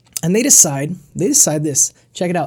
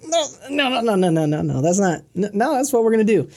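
insistent refusal; 3 semitones higher than usual. Retold or imitated. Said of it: "So, "What?".

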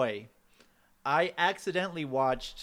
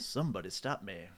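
deep Mask quote; 7 semitones lower, 8 dB quieter than usual. Retold or imitated. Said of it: "quoting the movie".

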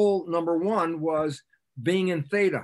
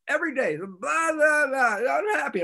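high, loud mocking blah blah; mock whiny complaint; 11 semitones higher, 3 dB louder than usual. Mimicking the unhappy person's daily moaning, high and mocking. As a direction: parody the endless complaining, pitched way up.

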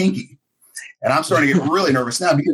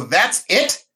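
raised, questioning echo of surprise; disbelieving question; 3 semitones higher than usual. Retold or imitated. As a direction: ask it as if it can't be over. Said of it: "I go".

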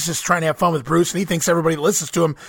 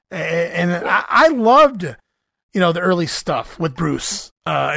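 usual speaking voice

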